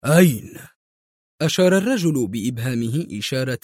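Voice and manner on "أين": loud, urgent one-word question; raised-voice demand; exclaiming. A narrator's reading. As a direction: snap out the question loudly. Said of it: "يهتف الأخير".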